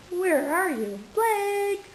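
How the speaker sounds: high, singsong calling; playful calling. Pretend. Mother pretending to search for her toddler, high playful call. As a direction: call out as if he's lost.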